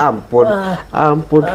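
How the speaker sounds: high laughing mock plea; laughing as you echo "Ampun".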